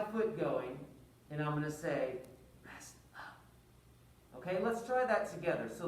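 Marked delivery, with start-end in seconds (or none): 2.6-3.4 unvoiced whisper. Shown as a demonstration. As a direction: breathe it with no voice. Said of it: "but I'm whispering".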